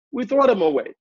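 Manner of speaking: normal talking voice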